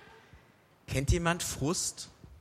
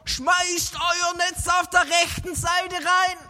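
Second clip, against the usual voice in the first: high, very slow, loud call; calling from the shore; 11 semitones higher, 6 dB louder, much slower than usual. Acted. The man on the shore calls to the fishermen, loud slow call. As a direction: shout the instruction across the water. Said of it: "Und dann schreit der vom Ufer".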